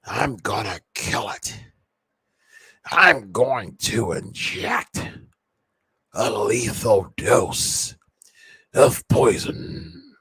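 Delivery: put-on slow, menacing raspy old man; raspy villain voice. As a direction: drawl the threat like a creepy old boss. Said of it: "talking like this all the time".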